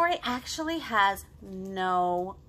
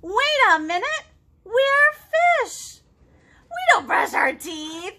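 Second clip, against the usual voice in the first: loud, high hammed-up exclamation; mock alarm; 14 semitones higher, 9 dB louder, slower than usual. Teacher pretending to be a shocked fish, loud mock alarm. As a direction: burst out in playful mock outrage.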